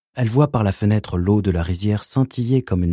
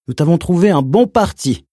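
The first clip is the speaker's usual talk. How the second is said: high, loud, honeyed announcement; sugary uncle voice; 9 semitones higher, 5 dB louder than usual. Acted. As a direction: sweet-talk her like a four-year-old. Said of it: "d'une voix doucereuse".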